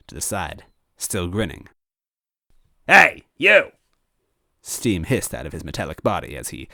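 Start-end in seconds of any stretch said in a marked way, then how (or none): 2.9-3.7 high, loud call out; robot shouting. Acted. Robot villain calling out to the toad, loud. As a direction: bark at the grinning toad.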